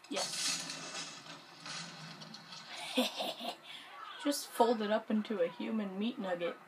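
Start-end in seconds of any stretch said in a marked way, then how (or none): 0.3-4.2 drawn-out, voiceless laughing fit; long wheezy laugh. Real, not acted. Gamer watching a body fold up, long wheezy laugh. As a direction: laugh on and on without voice.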